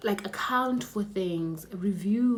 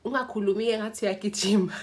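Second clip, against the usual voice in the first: lightly imitated mom, quick and higher; mom's scolding; 4 semitones higher, 4 dB louder, faster than usual. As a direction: quote mom with a touch of reproach. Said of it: "my mom is like".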